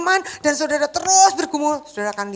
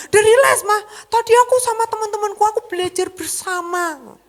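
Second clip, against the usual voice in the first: put-on much higher, laughing teen voice; mocking son's voice; 9 semitones higher, 5 dB louder than usual. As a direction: mimic the son's excuse with a laugh. (menirukan anak dengan suara tinggi)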